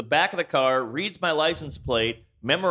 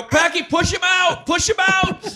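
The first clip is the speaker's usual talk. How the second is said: urgent yell; 13 semitones higher than usual. Retold or imitated. Driver screaming at Packy to push the guy out.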